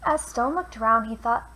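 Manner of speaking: put-on lively read-aloud for children; storyteller voice for kids; picture book reading. A narrator's reading. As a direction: read it like bedtime rhymes. Reads a rhyming picture book.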